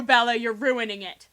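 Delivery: ordinary talk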